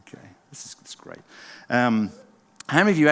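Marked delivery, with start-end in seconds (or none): 0.1-1.2 hushed aside. Real, not acted. Quiet aside to the audience.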